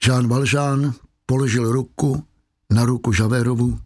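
ordinary delivery, nothing marked

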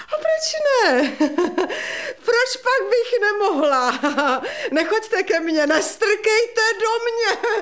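high, laughing, near tears; hysterical laughter. Acted. Widow taunting the visitor, hysterical laugh through tears.

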